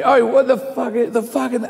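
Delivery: high, garbled sweary rant; abusive heckler. Retold or imitated. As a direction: imitate a street abuser mid-tirade. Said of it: "I've had people shout at me".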